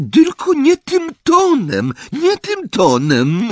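very high, loud squeaky aunt; shrill voice. A narrator's reading. Aunt squeaking at Harry's tone. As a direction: squeal the reproach. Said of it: "piskliwym głosem".

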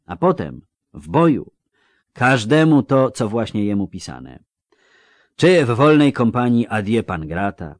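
gruff, hearty dwarf seeing a lad off to war; old dwarf. Acted. Dwarf speaks to the boy. (rubaszny stary krasnolud, głośno)

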